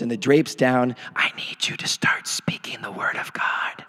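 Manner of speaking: soft unvoiced whisper. Shown as a demonstration. Pastor showing how to speak scripture alone, whispered. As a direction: whisper it as if the door is closed. Speaking God's word privately in room.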